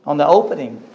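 usual speaking voice

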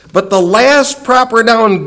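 ordinary talk, nothing marked

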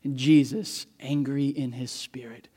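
soft, breathy whisper; hushed whisper. Acted. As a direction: lean in and let them strain to hear. Hushed turn after the loud preaching.

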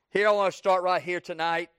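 usual speaking voice

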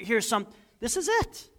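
high, hushed mysterious reveal; mock reveal. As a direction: tease a big secret. Mock secret key reveal.

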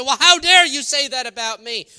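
loud, hammed-up indignant confrontation; mock angry accusation. Angry believer confronting the neighbor, loud mock outrage. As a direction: jab the finger and demand how dare you.

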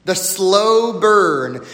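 ordinary delivery

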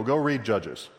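normal talking voice

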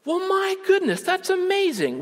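high-pitched gushing woman; imitated amazement. Woman impressed by his long list, high amazed imitation. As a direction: marvel at it, way up high.

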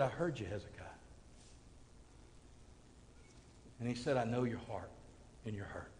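hushed, low, gentle words; tender compassion. Acted. Preacher voices God comforting Hezekiah, tender compassion. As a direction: speak softly and low to comfort.

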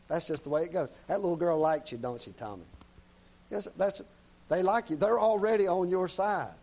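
normal talking voice

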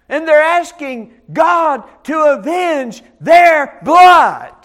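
building shout on blood. Real, not acted. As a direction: hammer the point home, shouting. Preacher stresses martyrs' vengeance.

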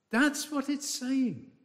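normal talking voice

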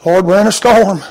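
ordinary delivery

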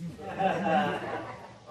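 loud delighted laugh; hearty laugh. Real, not acted. Preacher laughing at granddaughter's sweet remark, loud.

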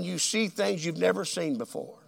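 ordinary delivery

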